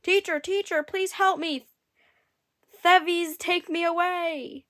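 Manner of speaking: high, loud panicked plea; frightened harp screaming for help. A narrator's reading. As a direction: cry out in terror to be saved. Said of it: "began to scream".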